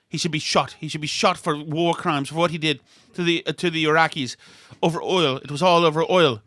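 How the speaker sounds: mock English accent drifting into Irish; indignant friend in a badly faked English accent; imitated English accent. Host imitates a friend condemning the war over oil, mock English accent. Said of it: "in her English accent".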